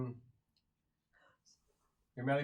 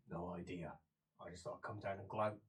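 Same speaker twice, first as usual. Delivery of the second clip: low, soft, unbothered reply; offhand gloating; 8 semitones lower, 10 dB quieter than usual. Acted. Visitor come down to gloat at prisoner, low and offhand. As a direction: answer quietly, pitched well down.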